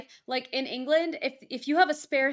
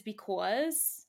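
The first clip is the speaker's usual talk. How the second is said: thick Bronx "because", said "bicawz"; New York Bronx accent on "because"; 4 semitones lower, 6 dB quieter than usual. Shown as a demonstration. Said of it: "very thick in her Bronx accent is".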